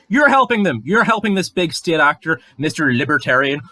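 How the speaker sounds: loud, high angry outburst; rising to a real angry shout; furious. Real, not acted. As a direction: yell the accusation right at them. Angry rant at antisemites.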